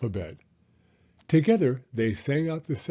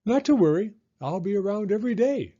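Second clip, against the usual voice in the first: high, louder and fast chirp; chirping bird; 8 semitones higher, 4 dB louder, faster than usual. A narrator's reading. Bird reassures Rocket she will stay, quick high chirp. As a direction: rattle it off cheerfully. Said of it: "chirped the bird".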